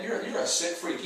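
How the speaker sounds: ordinary talk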